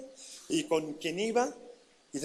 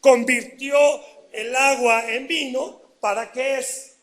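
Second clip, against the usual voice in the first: put-on louder, laughing drunkard's excuse; imitating a drunk, higher and louder; mock drunk voice; 4 semitones higher, 8 dB louder than usual. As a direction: ham up the drunk justifying his drink. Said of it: "El borracho, ¿sabe qué dice?".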